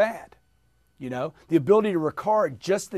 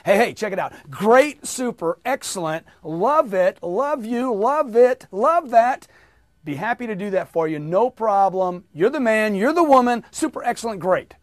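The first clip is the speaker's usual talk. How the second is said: loud, much higher, beaming positives; with a big smile; 4 semitones higher, 7 dB louder than usual. Shown as a demonstration. Coach showing the drill with a smile, exuberant. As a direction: grin and blast every word. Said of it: "Did you do it with a smile?".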